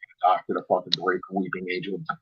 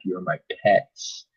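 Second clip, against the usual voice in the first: slow taunt; 5 semitones lower, 4 dB quieter, much slower than usual. Retold or imitated. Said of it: "She's like".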